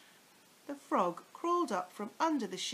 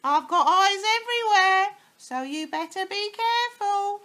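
high, loud witchy threat; gloating hag; 13 semitones higher, 15 dB louder than usual. Acted. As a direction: gloat over the trapped husband. Mrs Twit gloating threat.

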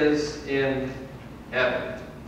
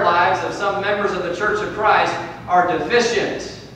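raised, loud rhetorical refrain; forceful preaching; 8 semitones higher, 9 dB louder than usual. Real, not acted. Preacher questioning how fervently people pray, forceful preaching. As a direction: build the refrain from the pulpit.

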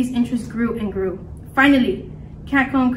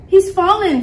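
high, loud exclamation; lightly voiced squeaky mouse; mouse bystander; 7 semitones higher, 6 dB louder than usual. A narrator's reading. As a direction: voice a small mouse onlooker. Mouse in the crowd speaks.

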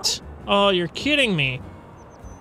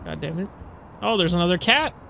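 ordinary talk

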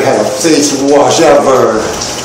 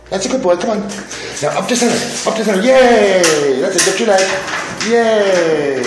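much higher, cheering the dog on; 9 semitones higher, 3 dB quieter than usual. Real, not acted. Coaxing, cheering dog up tray.

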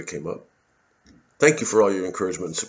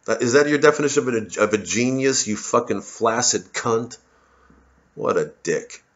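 usual speaking voice

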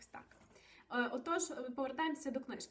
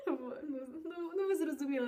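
laughing; 3 semitones higher, 4 dB louder than usual. Real, not acted. Laughs at own Bond joke.

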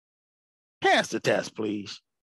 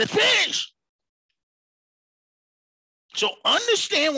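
preaching at full force; 7 semitones higher than usual. Acted. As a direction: preach it out, climbing and pressing hard.

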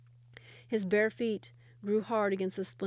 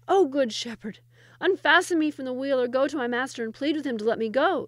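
loud, higher desperate plea; pleading; 5 semitones higher, 9 dB louder than usual. Acted. Trapped Sultan begs the shepherd boy, loud and desperate. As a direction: beg the shepherd to free you.

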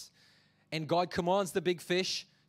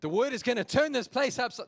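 higher, louder mock street preacher; mock overzealous coworker; 6 semitones higher, 5 dB louder than usual. Churchgoer preaching doom at coworkers, hammed-up and loud. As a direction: ham up a pushy preacher at the office. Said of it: "go to work this week and go".

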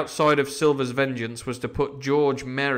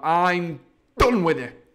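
slow, loud, higher final outburst; exasperated pirate; 5 semitones higher, 6 dB louder, slower than usual. Acted. Silver resigns as captain before the crew, exasperated. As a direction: throw up command in a temper.

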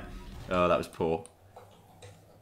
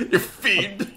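high, hammed-up old-time English accent with laughter; old-era accent; 9 semitones higher, 4 dB louder than usual. Gamer laughing at his own insult, mock old-time accent. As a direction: put on a bygone-era accent, pitched high. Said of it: "What era was that from?".

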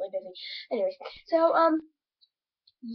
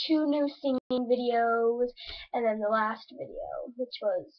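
usual speaking voice

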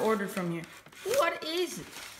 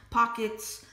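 ordinary delivery

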